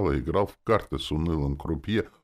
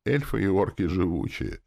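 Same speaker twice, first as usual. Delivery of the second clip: ordinary delivery, nothing marked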